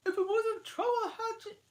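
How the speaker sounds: high, loud, tearful bird voice; complaining pigeon. Acted. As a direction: whine like a weary pigeon. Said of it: "said the pigeon".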